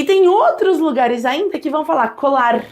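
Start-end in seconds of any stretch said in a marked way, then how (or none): 2.1-2.6 COLAR ending in a short tongue-tip R; tapped R. Shown as a demonstration. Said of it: "que é o som de".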